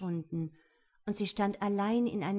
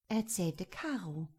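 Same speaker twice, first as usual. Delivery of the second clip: ordinary delivery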